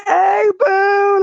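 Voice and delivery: high, loud sing-song hey boo; extra sweet greeting. Retold or imitated. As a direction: mark her territory with a sugary hello. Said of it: "trying to be all, you know, extra".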